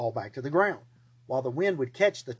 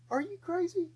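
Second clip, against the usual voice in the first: very high, disbelieving squeak; a shocked, strangled question; incredulous; 5 dB quieter than usual. Acted. Gideon reacting to the trumpet plan, very high and incredulous.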